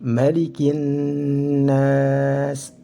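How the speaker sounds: "Malikin nas" with a long hummed double n; chanted "Malikin nas", l merged into n; lam syamsiyah. Shown as a demonstration. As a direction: recite "Malikin nas" slowly, holding the n and the a of nas. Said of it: "lam syamsiyah".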